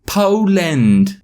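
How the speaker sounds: high, drawn caricature British voice; put-on British accent. British boy repeating his homeland, exaggerated British accent. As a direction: overdo a Russell Brand-style British accent. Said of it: "I'm sticking with the accent".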